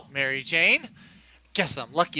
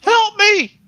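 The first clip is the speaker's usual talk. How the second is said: shouting; 8 dB louder than usual. Acted. Calling for help from the water.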